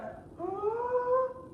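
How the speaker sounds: huge, high, drawn-in gasp; big inhale. Acted. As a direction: suck in air like a dragon. Dragon inhales deeply.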